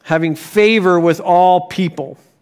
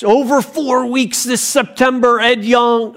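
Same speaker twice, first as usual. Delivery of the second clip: higher-pitched promo voiceover; 4 semitones higher than usual. Retold or imitated. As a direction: imitate a hyped ad voiceover. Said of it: "the video has the voiceover on it".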